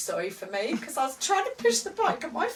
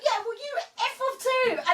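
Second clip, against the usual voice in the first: angry retort; 12 semitones higher than usual. Retold or imitated. Wife swearing back at husband beside her, high retort. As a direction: throw it right back at him. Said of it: "I was like".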